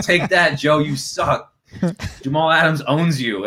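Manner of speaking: hammed-up heckle; mock jeering. Twitter haters taunting the reporter, mock jeering.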